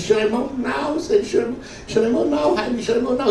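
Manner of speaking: high, insistent pleading; urgent, worked-up wife. Acted. Wife to husband at the height of lovemaking, urgent. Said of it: "they're huffing and puffing".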